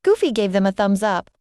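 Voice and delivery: ordinary delivery, nothing marked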